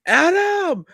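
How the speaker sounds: high, loud imitation scream; screamed name. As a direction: shriek the name like a wrestler entering. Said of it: "he just screams the person's name".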